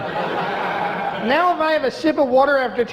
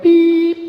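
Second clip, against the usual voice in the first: loud high mock beep tone; a sudden loud beep; emergency broadcast beep; 6 semitones higher, 7 dB louder than usual. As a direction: imitate the alert tone. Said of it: "hear that tone like".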